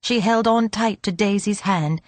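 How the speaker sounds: plain reading voice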